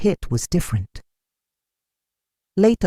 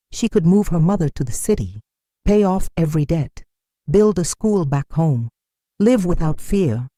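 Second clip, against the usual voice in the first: ordinary delivery, nothing marked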